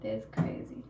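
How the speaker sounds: breathless whisper of disbelief. Real, not acted. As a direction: whisper it, too stunned to speak up. Stunned by comment on live.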